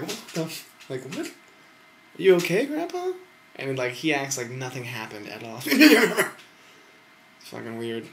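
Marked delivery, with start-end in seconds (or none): 0.0-3.1 high, loud, laughing what-the; startled disbelief. Retold or imitated. Grandson reacting to grandpa's noise, shocked and high. 5.7-6.4 loud high laugh; burst of laughter. Real, not acted. Recalling grandpa's noise, loud laugh.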